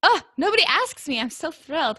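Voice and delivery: playful fake delight; hammed-up excitement; mock thrilled. Real, not acted. Jokes about never being asked.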